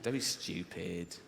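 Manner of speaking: a calm sneering voice of reason; rational inner voice. Retold or imitated. Comedian voicing the rational side of his mind, low and dismissive. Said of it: "this one goes".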